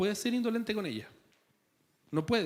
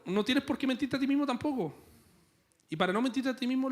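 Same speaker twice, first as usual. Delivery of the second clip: ordinary talk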